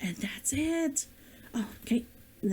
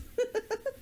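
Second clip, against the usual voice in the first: laugh; 10 semitones higher, 5 dB quieter than usual. Real, not acted. Laughing fit continues.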